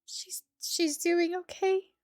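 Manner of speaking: high, slow, breaking reassurance; emotional. Real, not acted. Telling herself the cat is fine, voice breaking. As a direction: reassure yourself through tears. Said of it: "getting emotional".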